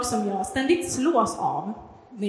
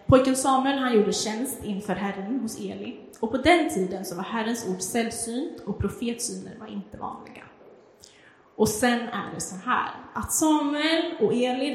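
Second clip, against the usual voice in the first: normal talking voice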